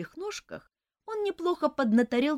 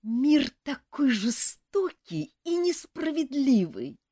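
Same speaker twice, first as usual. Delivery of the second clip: wheezed; 5 semitones higher, 3 dB louder, slower than usual. A narrator's reading. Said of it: "прохрипел ипохондриэль".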